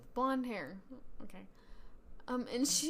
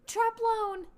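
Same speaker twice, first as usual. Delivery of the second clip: loud, high announcement; announcing; 10 semitones higher, 9 dB louder than usual. Acted. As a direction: call out the house name. Announcing sorting result aloud.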